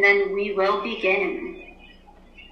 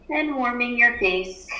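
guided meditation. Acted. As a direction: ease the class into stillness. Teacher leads class meditation.

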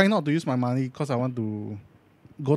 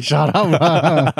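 laughing retort; 5 dB louder, much slower than usual. Retold or imitated. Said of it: "Then I straight away, like".

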